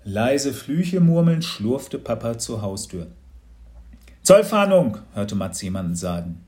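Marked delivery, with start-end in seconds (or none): 4.2-5.0 loud, higher-pitched customs officer; official announcement. A narrator's reading. Customs officer to the family at night, loud announcement. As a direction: announce yourself loudly at the door.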